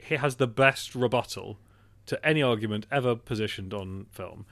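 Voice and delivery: ordinary delivery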